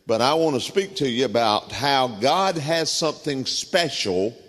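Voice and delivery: normal talking voice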